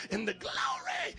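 "glory" stretched out as "glow-ray"; hammed-up preacher. Pastor hyping the retreat, "glory" drawn out as "glow-ray". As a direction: draw out "glory" in a showy preacher shout, ending "-ay".

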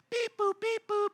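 high mechanical beeps; robot beeps. Pretend. God mocked as programming people, robot beeps. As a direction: beep like a toy robot.

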